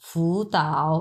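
"ফু তাও" drawn out as second plus third tone; deliberate teaching "ফু তাও"; tone change demo. Shown as a demonstration. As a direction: model "ফু তাও" with "তাও" dipping low. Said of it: "এইভাবে উচ্চারণ করতে হয়".

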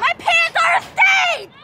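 high, slow, drawn-out cry; mock excited shout. Player hamming up a bit for the game, high mock shout. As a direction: announce it in a high, stretched yell.

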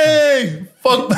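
high mock Brooklyn holler; Brooklyn accent. Shown as a demonstration. Comic asked to do Brooklyn, high hammed-up Brooklyn accent. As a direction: call out in a put-on Brooklyn accent. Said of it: "How do you do it with Brooklyn".

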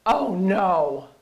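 loud, heavy cry of dread; imitating a husband's loud dismayed reaction; retold dismay. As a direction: let it burst out loud, as at terrible news. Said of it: "just by the tone of his voice".

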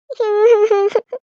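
short, breathy giddy laugh. Acted. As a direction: laugh out in silly delight. Dreamy girl calling her beloved. (மகிழ்ச்சி சிரிப்பு)

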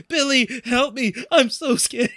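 high-pitched hammed-up plea for help; mock scared. Retelling the heroine's plea, hammed-up fright. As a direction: imitate a panicky damsel. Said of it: "When she goes".

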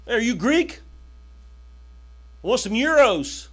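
lightly voiced joking stranger; a hint of a foreign voice, teasing; mock Greek questioner. Preacher voicing a stranger to Jesus, lightly mocking.

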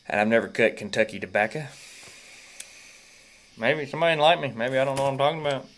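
ordinary delivery

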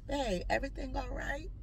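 quiet, gentle check-in question; soft answer. Retold or imitated. Wife carefully checking if everything is all right, softly. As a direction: ask it softly, keeping the peace. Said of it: "give a soft answer".

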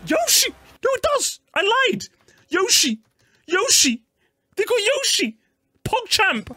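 thrilled high-pitched yells; excited yelling. Real, not acted. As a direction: shout it like you just spotted a friend. Excited at spotting Yoshi.

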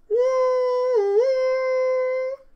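very high loud held woo; train whistle woo. Acted. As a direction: hoot like a steam whistle.

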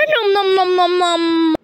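high-pitched pretend chomping; zombie munching. Zombie gobbles up the kid, high mock munching. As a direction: run the chewing noises together, high and droning.

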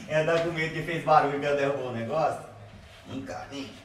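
usual speaking voice

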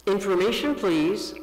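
put-on a small boy asking politely into the phone; child calling the operator. A narrator's reading. Little Paul calls the operator, a hint of a child's voice. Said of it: "and said".

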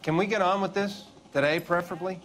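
impatient. Acted. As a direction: hurry them along, exasperated.

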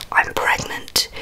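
normal talking voice

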